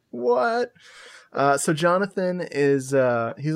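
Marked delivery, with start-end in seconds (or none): 0.1-0.7 high baffled what; stunned high question; incredulous what. Retold or imitated. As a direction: throw it up high in disbelief. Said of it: "And I'm like".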